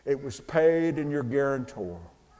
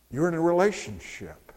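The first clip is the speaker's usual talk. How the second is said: ordinary delivery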